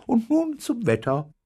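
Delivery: high-pitched female announcer voice; TV newsreader. A narrator's reading. Newsreader announces the reward for the egg, high announcer voice. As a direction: read it out high, as a news bulletin. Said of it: "sagte die Sprecherin".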